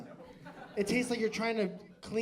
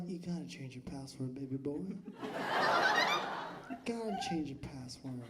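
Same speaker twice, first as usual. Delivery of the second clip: sexy voice; 3 semitones lower, slower than usual. Pretend. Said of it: "you go and you're like".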